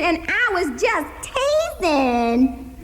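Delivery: slow, high, mocking repeat of his line; mocking echo. Hazel throwing her uncle's words back at him, slow mocking echo. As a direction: mimic him so he hears it. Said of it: "I say back just like he said it".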